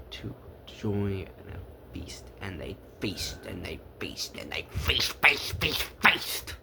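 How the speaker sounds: whispered, building feast feast; hushed feast refrain. A narrator's reading. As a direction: hush it then let it rise.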